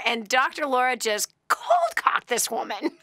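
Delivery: usual speaking voice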